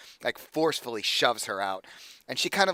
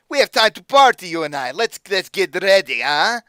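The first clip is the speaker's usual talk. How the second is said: much higher and louder, strained put-on accent; accented villain voice; 7 semitones higher, 13 dB louder than usual. Patron refusing to leave the booth, loud strained accent. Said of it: "Doing this voice is gonna kill me".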